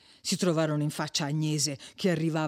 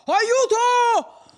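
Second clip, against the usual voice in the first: very high, loud, drawn-out yell; unrestrained cry for help; 7 dB louder, much slower than usual. A narrator's reading. Don Abbondio calls for rescue in the silent night, wild shout. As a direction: scream it out into the night. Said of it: "quel primo sgangherato grido".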